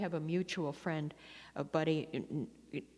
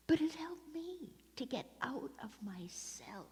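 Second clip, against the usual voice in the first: "get out of myself" in a voiceless whisper; whispered aside; 7 semitones higher, 5 dB quieter than usual. Real, not acted. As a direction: lean in and whisper it like a confession. Confides the point in a hush.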